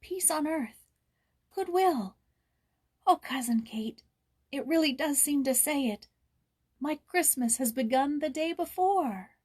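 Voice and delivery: high, rising with joyful wonder; rapt joy. A narrator's reading. Joyce hearing cathedral bells with Kate, rapt joy. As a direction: let the bells carry your joy.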